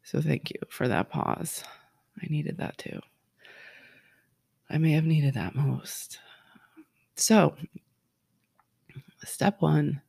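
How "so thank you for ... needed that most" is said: whisper. Real, not acted. Quiet moment after breathing pause.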